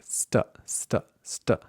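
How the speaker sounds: st with unaspirated t; short st, no puff after the t; S before T. Shown as a demonstration. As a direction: hiss s into a muted t on st. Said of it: "If you put the S before the T".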